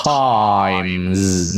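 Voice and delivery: "Times" drawn out long, every sound through the final S; careful model pronunciation. Shown as a demonstration. Teacher asking learners to listen closely, careful slow "Times". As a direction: stretch "Times" slowly to a long hissed ending. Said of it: "Nghe kỹ nè".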